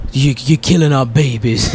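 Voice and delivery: slow, mock-dramatic film quote; lightly hammed-up bad acting; ham-fisted movie line. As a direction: imitate a heavy-handed movie plea, slowly. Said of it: "it's just so ham-fisted".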